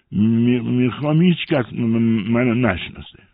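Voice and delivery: slow, high, loud put-on stammer; disguised stutterer. A narrator's reading. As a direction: drag it out, sticking on every m. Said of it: "انگار لکنت زبان داشته باشد".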